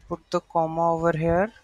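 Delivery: ordinary talk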